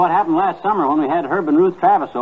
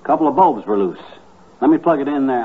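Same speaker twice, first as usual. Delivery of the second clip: ordinary delivery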